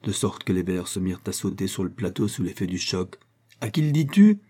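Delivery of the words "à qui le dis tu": high, loud challenge; furious, raised voice; angry challenge. A narrator's reading. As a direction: throw the question back angrily. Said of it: "en colère et frappant du poing".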